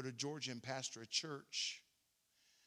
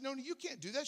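higher, urgent refusal; pleading with her not to; alarmed protest; 9 semitones higher than usual. Retold or imitated. Pastor protests Noni selling too cheap, pitched high.

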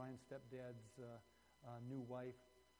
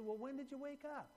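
driver's question; 12 semitones higher, 3 dB louder than usual. Retold or imitated. Driver questions boy in story.

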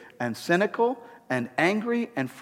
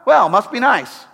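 higher, louder sarcastic must be nice; envious sarcasm; 5 semitones higher, 5 dB louder than usual. Retold or imitated. Scrolling social media envious of others on vacation, mock sarcastic sneer. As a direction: sneer at the fun others have. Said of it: "and you go".

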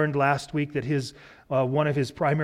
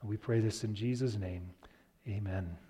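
prayer; 4 semitones lower, 9 dB quieter than usual. Real, not acted. Closing prayer, hushed.

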